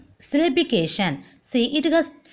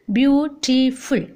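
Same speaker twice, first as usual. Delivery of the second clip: slow "beautiful" in three separate beats; split into syllables; slower than usual. Shown as a demonstration. Teacher counting three syllables for class, slow syllable by syllable. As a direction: draw out "beautiful" with gaps between syllables. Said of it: "Three syllable".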